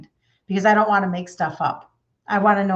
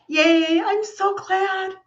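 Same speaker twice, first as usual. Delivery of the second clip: higher, slow and drawn-out gladness; delighted; 10 semitones higher, much slower than usual. Real, not acted. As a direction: gush with joy. Happy for a viewer.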